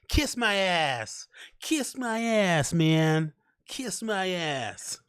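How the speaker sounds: high, very slow sing-song taunt; hammed-up slow high taunt; mock defiance. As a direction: drag it out like a teasing kid.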